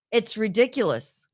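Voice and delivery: usual speaking voice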